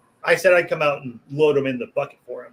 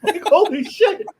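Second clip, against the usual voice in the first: very high, drawn-out laughing exclamation; 7 dB louder, slower than usual. Real, not acted. Storyteller cracking up at the dog punchline, laughing. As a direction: crack up through the words, pitch soaring.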